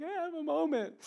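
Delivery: high, loud, hammed-up weepy voice; mock tearful protest. Dad caught weeping by his wife, mock tearful. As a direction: wail it like someone caught crying.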